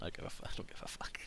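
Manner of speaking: hushed and quick; whispered aside. Real, not acted. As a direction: drop to a whisper for the swear. Quiet aside while chatting.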